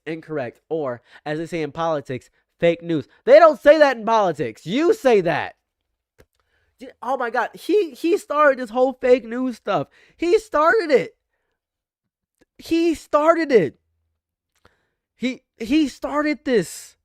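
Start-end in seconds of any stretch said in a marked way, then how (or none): 3.2-5.6 louder, higher call-out; loud retort. Real, not acted. Correcting Trump loudly. 6.8-16.9 much higher, slow drawn emphasis; incredulous disbelief. Real, not acted.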